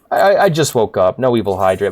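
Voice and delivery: normal talking voice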